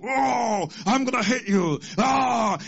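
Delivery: hammed-up demon howl; drawn-out, mock-menacing shout. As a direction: imitate a demon trying to scare people. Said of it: "and the demon's like".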